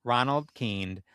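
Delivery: usual speaking voice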